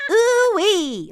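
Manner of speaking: high ooh-wee, kept short; short catchphrase. Shown as a demonstration. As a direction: pitch it up and don't drag out the ooh-wee. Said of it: "but it's quite short".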